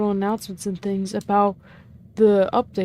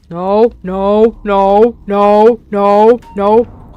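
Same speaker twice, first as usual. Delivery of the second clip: louder, lower repeated no; 3 semitones lower, 6 dB louder, much slower than usual. Real, not acted. Player refusing the game's outcome with loud, repeated no's. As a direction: hammer each no down.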